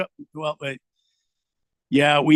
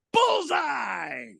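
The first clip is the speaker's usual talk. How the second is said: announcer call; 11 semitones higher than usual. Retold or imitated.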